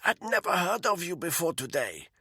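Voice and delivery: a frightened captive pleading; captive woman's voice. Acted. Malinka denies having the file.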